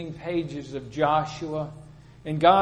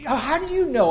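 1 challenging retort; 10 semitones higher, 7 dB louder than usual. Retold or imitated. Retold quarrel, friend challenges.

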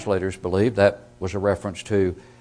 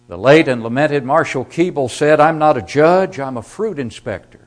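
preaching at full force; 7 semitones higher, 10 dB louder than usual. Acted. Preacher quotes Keeble forcefully.

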